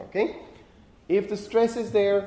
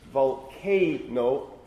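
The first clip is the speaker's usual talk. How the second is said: volcano very slowly, split as vol-CAY-no; syllable by syllable; 3 semitones lower, much slower than usual. Shown as a demonstration. Lecturer showing an open syllable, volcano slowly split with CAY picked out. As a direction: stretch volcano into syllables, leaning on the middle one. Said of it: "K is an open syllable".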